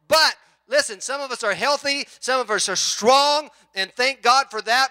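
high, loud exhortation to the congregation; rally the whole church, full voice; a pastor urging his flock at the top of his voice; full-force preaching. Acted.

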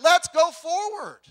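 loud, slow preacher's call; preaching push. Real, not acted. Pastor urging the church to move forward, loud and slow. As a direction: drive it home, slow and strong.

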